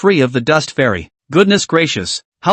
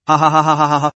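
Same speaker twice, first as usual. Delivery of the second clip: hearty laughter; faster than usual. A narrator's reading. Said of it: "rolling on the ground laughing".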